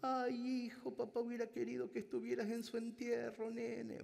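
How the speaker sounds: high, mock-tearful old mother; old mother's voice. Mother reproaching her son about the funeral, high mock-tearful voice. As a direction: voice her like a grieving old mom. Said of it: "La mamá dijo".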